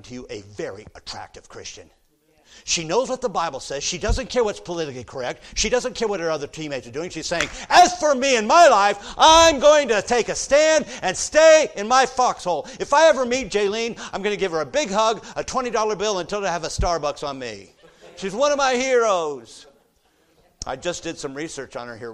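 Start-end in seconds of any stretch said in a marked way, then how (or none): 2.6-7.5 high, loud, fiery pulpit shouting; preaching at full force. Acted. Preacher praising Jaylene to the congregation, shouting. 7.7-12.7 high, loud declaration of faith; defiant shout. Retold or imitated. Preacher voicing Jaylene's stand, shouting. 12.8-19.7 high, loud, fiery pulpit shouting; preaching at full force. Acted. Preacher praising Jaylene to the congregation, shouting.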